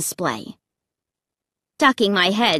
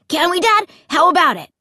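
put-on high-pitched excited kid; impatient, hopeful boy's plea; eager pestering; 8 semitones higher, 3 dB louder, faster than usual. A narrator's reading. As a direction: keep badgering Dad, pitched up eagerly. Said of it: "Luke demanded eagerly".

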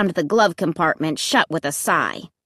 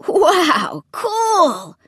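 put-on high, thrilled boy's exclamation; wowed and loving it; excited cry; 8 semitones higher than usual. A narrator's reading. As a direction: cry out in delighted awe, pitch way up. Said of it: "he cried".

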